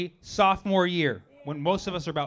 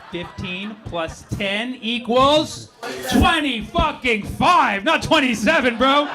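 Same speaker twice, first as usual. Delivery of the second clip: yelled, drawn-out triumphant sum; slow, loud, gloating count; triumphant shout; 6 semitones higher, 4 dB louder, slower than usual. Real, not acted. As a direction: bellow it like a victory. Triumphant math punchline.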